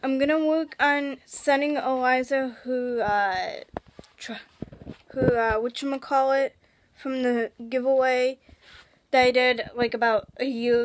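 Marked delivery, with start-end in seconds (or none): none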